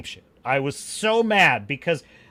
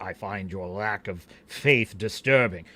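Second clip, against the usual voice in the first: Darth Vader; 10 semitones lower than usual. Acted. Vader rebuking a doubter in A New Hope, low menace. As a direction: drop low and measured like a dark lord. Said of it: "he's like".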